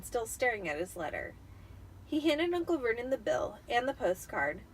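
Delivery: ordinary delivery, nothing marked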